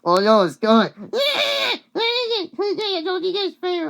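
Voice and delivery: loud, high mock-panicked cry; hammed-up high-pitched panic; acted panic. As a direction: wail in alarm, imitating a character. Acts out panic at a skunk.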